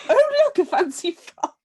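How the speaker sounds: high, loud thrilled squeal; hammed-up glee at a frock; mock delighted gasp. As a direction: swoon over the dress. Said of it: "Byron's like".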